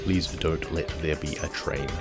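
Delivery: normal talking voice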